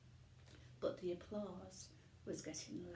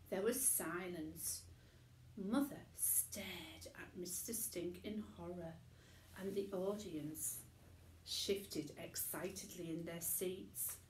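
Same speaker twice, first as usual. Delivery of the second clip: plain reading voice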